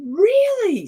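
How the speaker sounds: high, loud thrilled question; re-enacted high burst of excitement; excited surprise. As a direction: squeal it in delighted disbelief. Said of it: "So great excitement".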